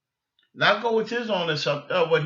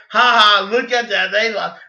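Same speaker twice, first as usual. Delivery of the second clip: gloating mockery; 7 dB louder than usual. Imitating cousin's gloating.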